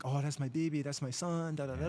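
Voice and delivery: understated retold lament; imitated crying mother. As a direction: lightly act out a grieving mother.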